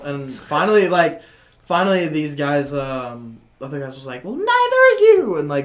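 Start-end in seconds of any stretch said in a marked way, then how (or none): 4.2-5.4 very high, loud retort; hammed-up defiant bystander; mocking comeback. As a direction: fire it back in a squeaky, raised voice. Retold bystander's comeback to heckler.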